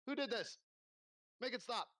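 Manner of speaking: exasperated demand. Acted. Innkeeper struggling with a man floating off the bed, exasperated and high.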